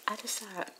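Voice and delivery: ordinary delivery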